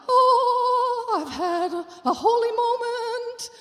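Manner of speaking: high, bright sing-song mock piety; mock holy gush. Wife to unbelieving husband, high mock-holy gush. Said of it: "And I'm like".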